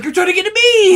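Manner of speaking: high, loud cartoon exclamation; Captain Planet. Comic voices a suffocating cartoon hero, mock yell. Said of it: "And Captain Planet's like".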